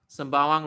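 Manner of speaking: "Sembawang" loud, the "ba-on" gliding into "bawang"; W-glide demonstration. Shown as a demonstration. Teacher showing the W feel, loud glided pronunciation. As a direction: punch out "Sembawang" with a W between the last vowels. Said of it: "So it's like".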